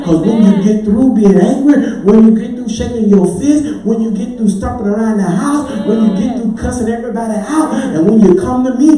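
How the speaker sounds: loud, driving, building pulpit cadence; swelling preacher's roar of assurance; full-force preaching. Real, not acted. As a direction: preach it in surging waves of rhythmic phrases.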